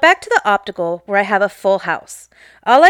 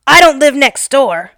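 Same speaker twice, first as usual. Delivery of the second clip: retold angry yell; 3 semitones higher, 7 dB louder than usual. Retold angry customer yelling.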